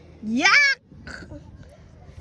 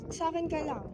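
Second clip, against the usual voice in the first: ordinary delivery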